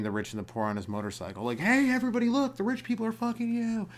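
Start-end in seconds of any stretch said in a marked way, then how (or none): 1.6-3.9 high, loud stuntman holler; mock daredevil announcement, high and strained; Evel Knievel. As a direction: call out to a whole crowd, pitched way up. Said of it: "I feel like Evel Knievel needs to come back".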